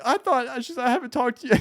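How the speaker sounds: mildly high imitation of grandma. Grandson returns grandma's call, mildly high grandma voice. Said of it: "And she's like".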